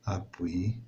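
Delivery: whispered. Shown as a demonstration. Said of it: "بحال نقولوا ابوي دو تيت".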